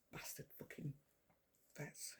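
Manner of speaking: barely voiced whispered swearing under the breath; muttered curse. Real, not acted. Cursing the vets under her breath, whispered. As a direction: hiss it to yourself, almost silent. Annoyed aside about the vets.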